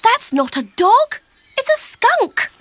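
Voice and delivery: very high, loud urgent zookeeper; excited zookeeper. A narrator's reading. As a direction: warn her it's a skunk. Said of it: "said the zookeeper".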